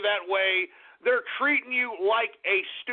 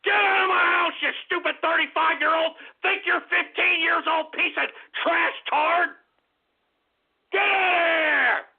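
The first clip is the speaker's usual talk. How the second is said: angry shout; 7 semitones higher than usual. Retold or imitated. Imagined parents screaming at their grown son, furious shout. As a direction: throw him out at the top of your voice. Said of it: "that's what they should be doing".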